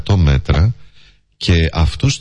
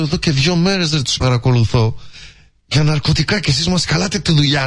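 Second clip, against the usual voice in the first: bossy cop voice; 10 semitones higher than usual. Retold or imitated. Posing as stern policeman.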